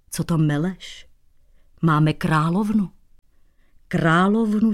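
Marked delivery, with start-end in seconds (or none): none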